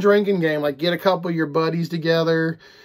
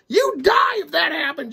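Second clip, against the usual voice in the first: mock exclaim; 11 semitones higher than usual. Exclaimed verdict.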